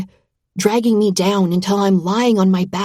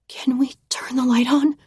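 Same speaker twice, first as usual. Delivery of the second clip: small breathy whisper, higher; murmured whisper; 5 semitones higher than usual. A narrator's reading. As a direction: murmur it nervously. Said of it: "I murmur".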